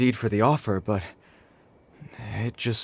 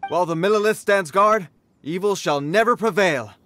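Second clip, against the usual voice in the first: high, ringing soldier's oath; rousing proclamation; 8 semitones higher than usual. Acted. Soldier vows the Millelith will guard against evil, rousing proclamation. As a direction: proclaim it like a sworn motto.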